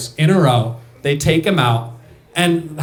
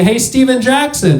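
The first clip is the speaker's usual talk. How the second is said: high-pitched courtside call; retold holler to a player; 8 semitones higher, 3 dB louder than usual. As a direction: call over to get a player's attention.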